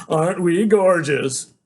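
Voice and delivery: a slow self-admiring drawl, mocked; mock vain crowd. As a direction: sneer it like a show-off. Mocking the cool beach crowd.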